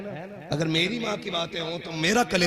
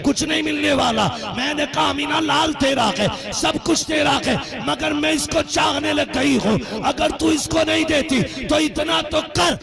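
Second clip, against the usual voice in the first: high, impassioned sermon storytelling with a sung lilt; preaching at full force; 8 semitones higher than usual. Acted. Preacher telling the congregation of Halima, at full force. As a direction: preach the tale, rising and half-singing.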